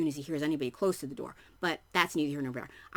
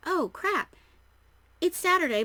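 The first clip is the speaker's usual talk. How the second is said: high-pitched mock alarm; sudden worked-up realization, voice jumping up; retold dismay; 13 semitones higher, 5 dB louder than usual. Retold alarm at missing books.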